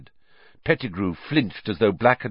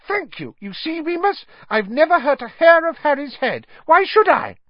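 very high squeal, getting louder; Pettigrew, shrill; 7 dB louder than usual. A narrator's reading. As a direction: shrilly protest innocence. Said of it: "said Pettigrew shrilly".

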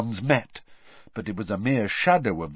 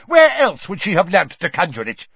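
higher, louder demand; shouting; 8 semitones higher, 9 dB louder than usual. Acted. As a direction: hurl the question. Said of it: "shouted Mister Crouch".

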